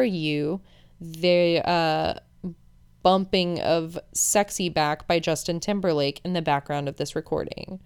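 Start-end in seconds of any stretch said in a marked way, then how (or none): none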